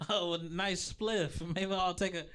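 high, giggly stoned voice; stoner voice. Pretend. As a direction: act baked and giddy. Acting high on Gorilla Glue.

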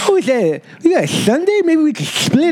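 exaggerated lisping kid voice, high; mock a heavy lisp. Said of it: "such a serious lisp".

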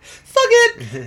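high, loud mocking shout; taunting yell. Mimics the wrestlers' taunt.

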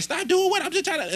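high-pitched mock of a woman protesting; imitating front-desk girl. Front-desk girl defending herself to delivery driver, high imitation. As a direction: pitch it way up, quoting her objection. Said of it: "And she was like".

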